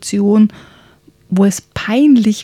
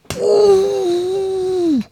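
much higher, hammed-up giant shout; loud giant call; 12 semitones higher than usual. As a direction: burst out like a grim giant. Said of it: "rief er laut". (lauter Ruf des Riesen, übertrieben gespielt)

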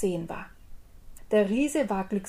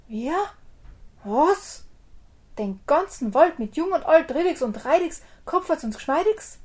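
high, disbelieving giant repeating; can hardly believe his ears; bewildered giant; 7 semitones higher, 5 dB louder than usual. Acted. Giant repeats the tailor's boast, high and baffled.

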